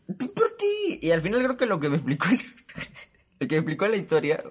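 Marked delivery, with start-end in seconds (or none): 0.1-1.0 very high strained bien por ti; strained fake congrats. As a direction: squeak out a brave fake congratulation.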